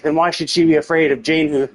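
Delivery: usual speaking voice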